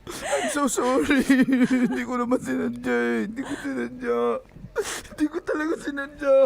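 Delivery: sobbing apology; crying. Real, not acted. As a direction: plead through sobs that you didn't mean it. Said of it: "Babe, umiiyak ka pa".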